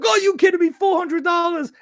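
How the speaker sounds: high-pitched, half-hearted retold yell; mock yell. Retelling his yell at the price reveal, mock yell pitched high. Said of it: "I was yelling".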